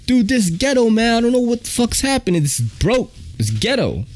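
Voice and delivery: high-pitched mock conductor in a fluster. Panicked conductor's quoted reply.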